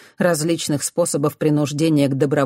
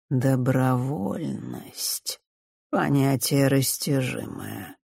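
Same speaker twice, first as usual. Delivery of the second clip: very slow, savoured words; almost relishing; 2 semitones lower, 4 dB quieter, much slower than usual. A narrator's reading. Director toys with the idea of willingness, relishing it. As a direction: draw each word out with quiet relish. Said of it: "почти с наслаждением проговорил директор".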